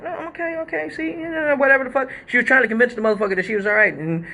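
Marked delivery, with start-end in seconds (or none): 0.0-2.1 squeaky, much higher protest that she's fine; high insistent plea. Retold or imitated. Stripper pleading with the DJ, high and insistent. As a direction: pitch it way up, brushing off concern.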